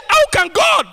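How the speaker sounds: high, loud crying complaint; jealous brother's outcry. Retold or imitated. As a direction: wail and shout the unfairness.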